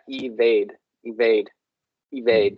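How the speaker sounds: "evade" said as ee-VAYD, with a v; pronunciation of evade. Shown as a demonstration. Showing a language partner how "evade" is pronounced, clear ee-VAYD. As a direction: repeat "evade" separately, clear ee-VAYD each time. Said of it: "yeah, it's pronounced".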